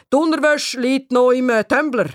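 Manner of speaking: dad fumbling for where the laundry is; father's voice. A narrator's reading. Father answers in his own voice.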